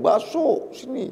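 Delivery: high-pitched, softened call to a street vendor; a half-voiced pretend holler; mock calling out. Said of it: "baru diteriakin".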